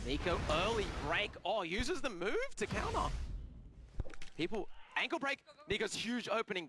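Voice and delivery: quick, rising play-by-play; excited commentary. Acted. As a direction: call the action as it happens.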